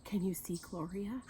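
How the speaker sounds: ordinary delivery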